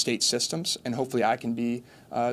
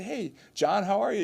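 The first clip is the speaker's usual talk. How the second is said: bright high call of hello; cheerful greeting; 8 semitones higher than usual. Retold or imitated. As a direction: greet him like an old friend across a crowd. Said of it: "I probably saw sixty people ... say".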